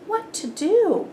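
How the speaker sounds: ordinary delivery, nothing marked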